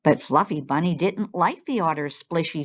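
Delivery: ordinary delivery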